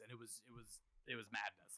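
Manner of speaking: ordinary talk, nothing marked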